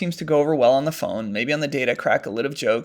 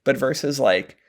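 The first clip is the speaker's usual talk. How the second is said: ordinary talk, nothing marked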